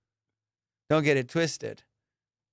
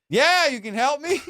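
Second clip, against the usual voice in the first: pointed retort; 7 semitones higher, 4 dB louder than usual. Retold or imitated. Acted retort to the lady.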